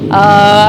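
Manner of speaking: loud, rough zombie groan; baby zombie growl. Acted. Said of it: "I'm like a baby zombie".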